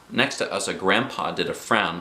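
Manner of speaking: usual speaking voice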